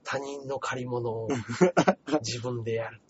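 low, soft, measured musing with pauses; mock-literary lament. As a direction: intone it like a brooding writer. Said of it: "文学的なことを言ってましたけど". (文学調に静かに嘆く)

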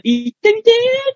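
high slow sung-out call; mock TV show shout-out; imitating TV catchphrase. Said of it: "やってたじゃん".

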